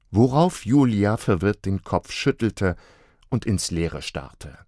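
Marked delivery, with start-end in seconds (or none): none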